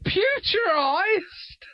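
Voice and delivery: squeaky disbelieving echo of the word, drawn out with a laugh; laughing at a made-up word; incredulous. Real, not acted. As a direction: repeat the odd word in high, slow, laughing disbelief. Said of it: "What's that word mean".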